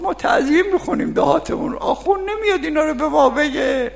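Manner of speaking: high, loud rustic voice ending in a laughing shout; shrill mocking village voice, laughing; mock villager. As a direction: lampoon a proud ignorant peasant.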